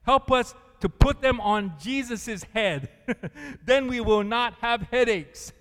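loud, earnest prayer voice; reading a prayer aloud. Acted. Preacher reading the basket prayer aloud, loud and earnest. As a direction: proclaim the prayer with a smile. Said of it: "the prayer said".

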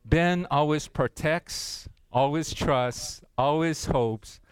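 slow, careful recitation with a few nervous laughs; slow reading. Shown as a demonstration. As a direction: read it slowly, your own name in place of love. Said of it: "Let's say it slowly".

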